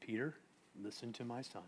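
very soft, low warning; God's hushed rebuke. Acted. God the Father telling Peter to listen, low and hushed. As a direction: rebuke him gravely, almost under the breath. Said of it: "A holy rebuke from God the Father".

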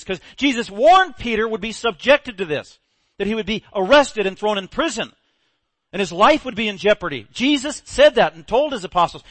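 preacher stirring up the congregation; full-force preaching. Acted. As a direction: drive it home from the pulpit.

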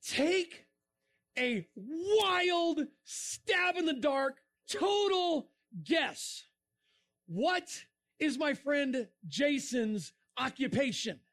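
high, very slow, stretched-out words; drawn-out rhetorical suspense; teasing build-up. Real, not acted. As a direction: hang on each word before the reveal. Sets up punchline for audience.

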